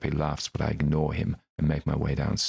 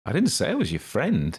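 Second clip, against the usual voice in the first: teasing wink; 9 semitones higher, 3 dB louder than usual. Acted. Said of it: "he winks".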